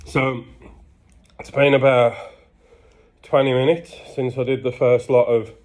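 ordinary talk, nothing marked

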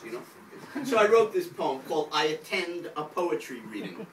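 ordinary delivery

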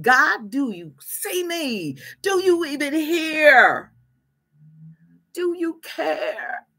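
slow, drawn-out cry to God; an imploring prayer, long-held words; anguished plea. Acted. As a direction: plead it slowly, stretching each word. Acting people's anguished plea to God.

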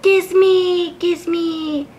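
high, put-on little creature pleading; Bellsprout flower voice. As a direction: squeeze out a high cartoon plea. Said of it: "It's like".

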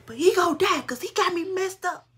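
high, loud angry outburst; defiant yell at mama about the cheating man; furious refusal. Retold or imitated. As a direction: shout it back at mama, fuming. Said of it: "I'm like".

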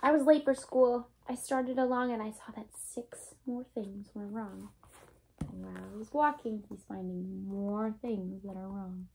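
lively storybook read-aloud, bouncy and bright; reading to children. A narrator's reading. Teacher reading a Dr. Seuss book to her class, lively read-aloud. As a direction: read it like story time for little ones.

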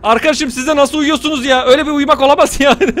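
usual speaking voice

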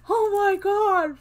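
high, loud, drawn-out fright shriek; jump-scared yelp from the T-Rex; startled scream. Retold or imitated. T-Rex roars behind her.

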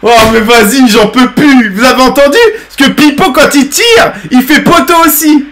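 can't take it anymore, tell the chat; exaspération excitée. Real, not acted. Exaspération hilare au stream. (plus aigu, fort, exaspéré en riant)

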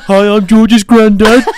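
high, loud, slow silly voice; mock granddad. Guest pretending to be host's granddad, high laughing voice. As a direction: put on a silly granddad greeting through laughter.

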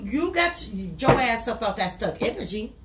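ordinary delivery

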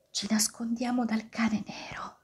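hushed, breath-only whisper; very soft storybook whisper. A narrator's reading. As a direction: whisper it from a hiding place.

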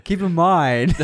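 slow, rising Tommy Wiseau drawl; Tommy Wiseau impression. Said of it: "it's Tommy Wiseau".

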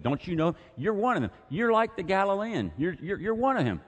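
corner him with each phrase; frustrated accusation. Retold or imitated. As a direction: crowd accusing Peter, high and insistent. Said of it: "This is what he said".

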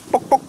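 high squeaky guinea pig noise, "bock, bock"; guinea pig squeak. Retold or imitated. Joking to viewers about guinea pigs, high mock squeak.

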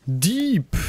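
high, loud accusing yell; shouting. Real, not acted. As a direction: yell it at the enemy. Yells at opponent in game.

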